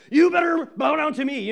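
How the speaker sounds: loud, high barking threat; barking shout. Acted. King barks at the three men with a shout. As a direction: bark it like a huge attack dog. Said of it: "there's this enormous bark".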